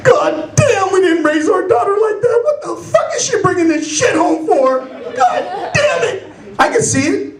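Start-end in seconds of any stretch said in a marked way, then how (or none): 0.0-6.2 high, indignant mother-in-law rant; acted horrified mother, pitched up; outraged mother-in-law. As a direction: lament in comic outrage. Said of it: "And in her mind, it's going".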